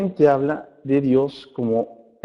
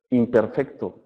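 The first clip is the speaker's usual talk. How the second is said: ordinary talk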